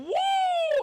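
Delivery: long high-pitched "woo"; party whoop. Acted. Preacher mimics last year's party, high whoop. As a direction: whoop in a sustained high note.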